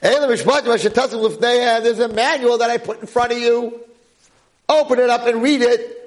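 high-pitched, forceful proclamation; preacher's screaming. Real, not acted. Rabbi urging listeners to read the manual, screaming. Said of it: "doesn't like me screaming".

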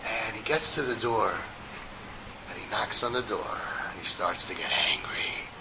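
low, hushed storytelling, sinking toward a whisper; hushed suspense. A narrator's reading. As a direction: drop your voice before the outburst. Storyteller builds suspense before outburst.

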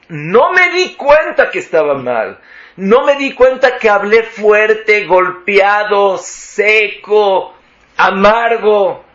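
high, loud emphatic declamation; forceful preaching. Acted. Preacher confesses not noticing his own harshness, forceful preaching.

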